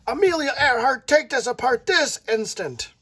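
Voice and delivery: a hint of a cross grandma calling out; grandma yelling at the girl to take it apart; scolding grandma. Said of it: "Grandma comes out yelling at her".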